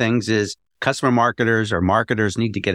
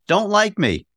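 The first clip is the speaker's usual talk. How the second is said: higher, upbeat podcast sign-off; signature sign-off; 8 semitones higher than usual. Retold or imitated. Host quoting his closing line to listeners, upbeat. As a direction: sing out his catchphrase to listeners. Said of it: "I always end these podcasts by saying".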